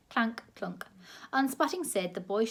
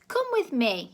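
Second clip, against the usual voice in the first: sharp, higher, louder command; demanding; 5 semitones higher, 6 dB louder than usual. A narrator's reading. Grandma yanking the boy away, loud demand. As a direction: order him to come. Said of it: "she demanded".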